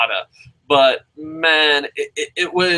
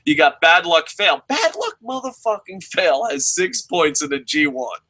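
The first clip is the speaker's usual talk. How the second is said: ordinary talk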